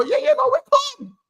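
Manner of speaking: very high, squeaky reassurance; schoolkid covering up. Retold or imitated. Schoolkid refusing to snitch to the head teacher, in a very high voice. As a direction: shoot right up high, insisting it's fine. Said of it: "You go".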